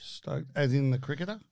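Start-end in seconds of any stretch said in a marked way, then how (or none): 0.0-0.5 quiet echo. Pretend. Repeating stoked.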